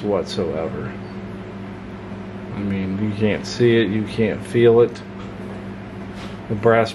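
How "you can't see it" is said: usual speaking voice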